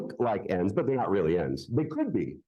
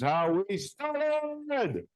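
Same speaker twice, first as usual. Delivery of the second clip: comic punchline; 10 semitones higher, much slower than usual. Acted. Comic punchline after rope trick.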